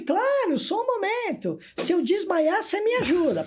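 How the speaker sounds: very high, playful clown reply; comic clown voice. Retold or imitated. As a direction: squeak it out like a jittery clown. Clown plays along with the patient.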